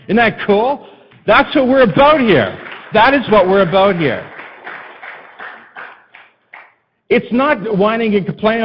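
high, loud exhortation to the congregation; impassioned preaching. Real, not acted. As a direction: drive the point home like a fired-up preacher. Preaches at full force.

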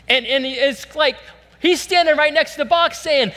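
loud, high, driving preacher voice; fervent preaching. Acted. Pastor urging the congregation, fervent loud preaching. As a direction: drive it home to the church.

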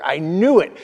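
ordinary talk

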